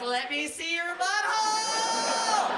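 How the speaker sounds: high, drawn-out creep voice; creepy alien. Acted. Cosmic creep flown light years to make a demand, high drawn-out holler. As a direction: stretch it out high and loud, like a leering alien.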